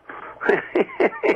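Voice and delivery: short laugh at the memory; amused laugh. Real, not acted. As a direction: let out a quick amused laugh. Laughing at the minister's words.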